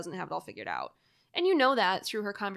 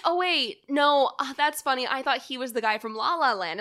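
mock excited confusion; 4 semitones higher, 7 dB louder than usual. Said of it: "I'll be like".

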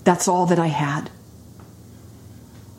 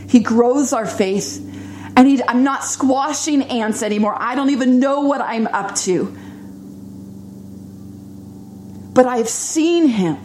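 high, fervent preaching climb; 6 semitones higher than usual. Acted. Preacher testifying to the congregation, rising fervent preaching. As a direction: testify with growing fervour.

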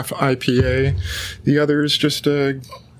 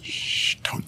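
whisper; 9 dB quieter than usual. Acted. Telling them to keep secret.

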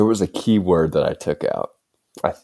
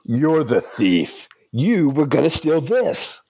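higher, slow, throwing the blame back; turning it back; 9 semitones higher, slower than usual. Acted. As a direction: push the accusation right back. Acted anime scene, character A.

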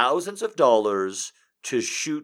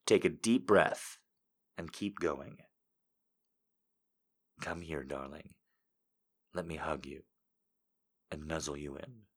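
seductive voice; 6 semitones lower, 8 dB quieter than usual. Pretend. Reading a viewer quote in a low sultry voice. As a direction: purr it low and intimate. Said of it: "I say it".

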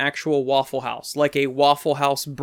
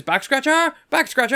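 high-pitched goofy waiter call; wacky waiter; 10 semitones higher than usual. Retold or imitated. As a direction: sing out the gag to the kitchen. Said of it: "he goes".

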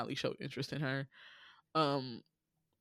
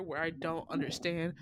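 ordinary talk, nothing marked

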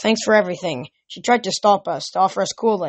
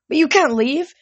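high-pitched, pleading objection; high protest; 9 semitones higher, 4 dB louder than usual. Acted. Old mother begging her guests to stay, high protest.

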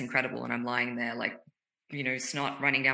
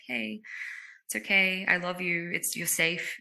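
higher, gentle comforting voice; soothing inner child; 5 semitones higher than usual. Pretend. A parent gently hushing their crying child, soothing.

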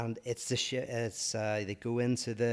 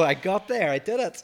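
much higher and louder proud cheer; excited; 9 semitones higher, 8 dB louder than usual. Real, not acted. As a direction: crow it out with pride. Proud after solving the puzzle.

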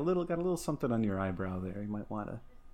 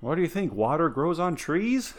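mock scolding parent; 6 semitones higher, 7 dB louder than usual. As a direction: talk down like an annoyed dad.

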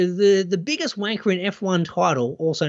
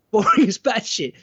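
laughing; 6 semitones higher, 4 dB louder than usual. Real, not acted. Laughing repeat of cohost's joke.

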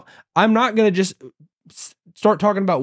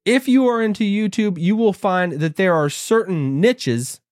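usual speaking voice

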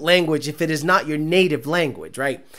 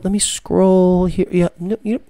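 ordinary talk

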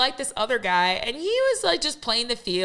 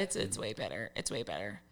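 quiet aside; 12 semitones lower, 13 dB quieter than usual. Real, not acted. Quiet emphatic afterthought.

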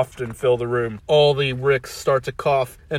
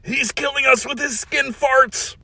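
gasping Rick; 13 semitones higher than usual. Acted. Dying Rick.

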